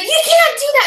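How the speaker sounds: loud, high imitation of a screaming neighbour; retold screaming. Neighbour objects to the dog walkers, screaming high. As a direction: yell it way up high, mimicking her. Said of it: "she starts screaming at me".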